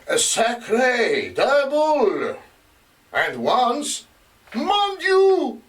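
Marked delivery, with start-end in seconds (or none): 0.1-2.4 gruff Frenchman. Retold or imitated. Investigator quoting the gruff voice from the house, mock French oath. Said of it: "the gruff voice said repeatedly". 4.5-5.6 much higher, exclaimed French cry of dismay; gruff Frenchman. Retold or imitated. Investigator quoting what the gruff voice once cried, French exclamation. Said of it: "the gruff voice said repeatedly".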